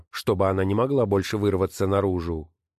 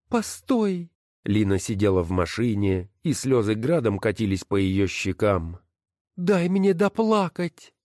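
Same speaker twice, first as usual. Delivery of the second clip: wife's voice. Acted. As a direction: sneer at each title as you sort them. Wife stops her husband. (высокий насмешливый голос жены)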